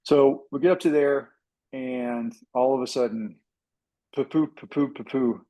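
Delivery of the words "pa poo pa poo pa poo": engine sputter imitation. Pilot showing viewers how the engine began to sputter, mouthed sputter. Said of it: "It starts to sputter".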